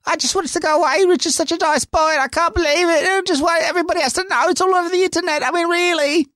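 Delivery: mock the upset mother with a failed Australian accent, high up; bad Australian accent. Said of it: "I can't do an Australian accent right now".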